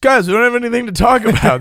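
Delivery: high, loud mock whine; mock complaint. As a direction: whine it like a bored kid. Quoting themselves making an excuse.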